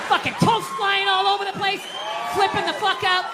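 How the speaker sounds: shouting. Retold or imitated. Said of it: "absolutely lost it".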